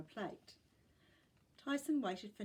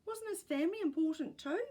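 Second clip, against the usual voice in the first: put-on louder, sharp indignant boy; snapping; 8 semitones higher, 6 dB louder, faster than usual. A narrator's reading. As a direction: snap the question back. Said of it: "snapped Tyson".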